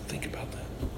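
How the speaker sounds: hushed whisper to the congregation; whispered aside, nearly silent. Real, not acted. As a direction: drop to a breath for effect.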